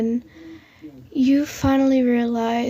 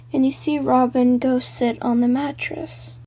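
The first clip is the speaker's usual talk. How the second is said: usual speaking voice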